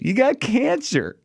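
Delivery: very high, slow mock-cheery delivery; smiling doctor. Imitating dermatologist giving biopsy results to the patient, smiling cheerily. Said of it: "he's smiling".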